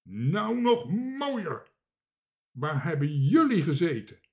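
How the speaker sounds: furious. A narrator's reading. Gijs answers his searchers, red with rage. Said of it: "werd hij rood van woede".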